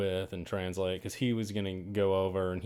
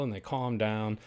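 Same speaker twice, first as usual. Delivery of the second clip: ordinary talk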